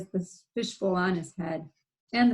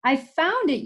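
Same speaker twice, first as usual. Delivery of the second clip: loud, higher call; yelled; 7 semitones higher, 5 dB louder than usual. Acted. Froggy calling to his mother, yelling. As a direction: call it out proudly. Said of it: "yelled Froggy".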